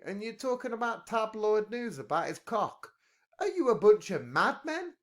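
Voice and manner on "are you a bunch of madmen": much higher, louder disbelieving cry; what on earth are you doing, exasperated; incredulous. Real, not acted. Incredulous at Ukraine tabloid jibes.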